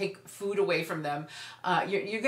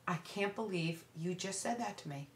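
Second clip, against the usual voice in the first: soft, much quieter, hurt disbelief; not yelling; 10 dB quieter than usual. Shown as a demonstration. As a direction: parent answering teen's insult, quiet and hurt. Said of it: "In that tone of voice, you're not yelling".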